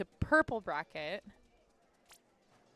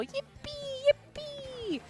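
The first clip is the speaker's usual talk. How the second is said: high yippee; much slower than usual. Real, not acted. Cheery mock celebration.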